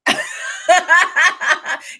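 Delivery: a high, loud burst of laughter. Real, not acted. Twin cracks up at the sarcastic retelling. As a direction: crack up at the story.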